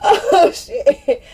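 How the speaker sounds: high-pitched, words bubbling through real laughter; laughing at herself. Real, not acted. Admitting she laughs at herself, real laughter in voice. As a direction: recover from a laughing fit as you talk. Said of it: "I be laughing at myself".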